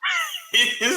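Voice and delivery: a high, sudden cackle; cracking up at the story; burst of high laughter. Real, not acted. Reacting to the [name] story.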